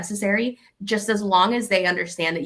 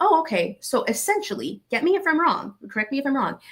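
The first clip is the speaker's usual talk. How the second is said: mock pleased surprise; 7 semitones higher than usual. Acting out the friendly reframe.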